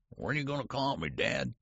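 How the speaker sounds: high, quick quavering question. A narrator's reading. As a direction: ask it in a high, quavery old man's voice. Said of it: "he quavered".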